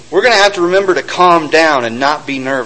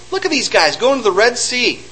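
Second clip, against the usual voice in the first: mocking laughter; 6 semitones higher than usual. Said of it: "Even Pharaoh was sitting there going".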